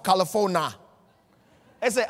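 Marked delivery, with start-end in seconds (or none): 0.0-0.7 "California" said as "Cali-fo-na"; mock-botched "California", ending in "-fona"; mispronounced state name. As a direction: drop the i from the end of "California". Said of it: "you can't even pronounce".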